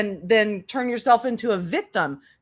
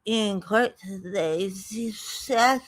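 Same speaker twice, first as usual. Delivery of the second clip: mock drowsy reading; 4 dB quieter, slower than usual. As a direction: drag it out as if dozing off over the script. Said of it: "reading comments and being like".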